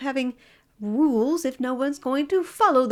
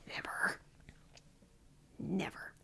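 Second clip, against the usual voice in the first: much softer, whispered defiant never; whispered refusal; 5 semitones lower, 17 dB quieter than usual. Pretend. Refusing to apologize to her co-host, whispered. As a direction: breathe a stubborn refusal.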